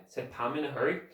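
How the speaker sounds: ordinary delivery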